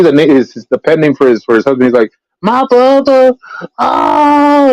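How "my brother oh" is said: high, slow, sobbing mock lament; imitating crying. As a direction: wail it like a killer putting on grief. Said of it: "like, crying".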